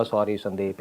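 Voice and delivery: soft, low apology; mock-apologetic low voice; funny teacher voice. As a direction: imitate a sheepish teacher comically. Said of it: "उनकी आवाज़ थी बड़ी funny सी".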